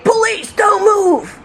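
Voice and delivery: slow, high, loud police shout; big booming voice. Retold or imitated. Officer surprised by own trained command, booming shout. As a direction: boom out the command, drawn out. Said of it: "this big booming voice say".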